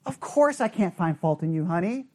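low, soothing mock reassurance; placating spouse. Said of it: "The answer is supposed to be".

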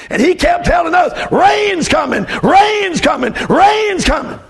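put-on high, urgent boy reporting to Daddy, building to a shout; excited little boy. Frightened boys telling Daddy about rain.

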